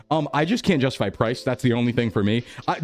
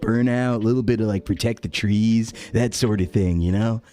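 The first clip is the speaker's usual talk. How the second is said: lightly voiced hippie burnout; 3 semitones lower than usual. Shown as a demonstration. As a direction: drift along like a mellow burnout on a spirit journey. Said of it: "in the range of".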